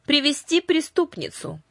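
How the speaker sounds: high, sharp command; commanding order. A narrator's reading. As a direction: order it from the throne. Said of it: "приказала она фаморам".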